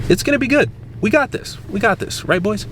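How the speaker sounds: high, hyped-up pep talk; pumped up. Real, not acted. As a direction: hype the crew up. Hyping himself up.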